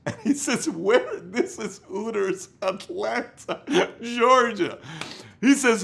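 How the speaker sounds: laughing. Real, not acted. Laughing while retelling the punchline.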